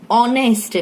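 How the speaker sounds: "honest" said as "onest", starting on O; silent h. Shown as a demonstration. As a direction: drop the H in "honest" and begin on the vowel. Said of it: "So here H is silent". ("honest" में एच silent, ओ से बोलें)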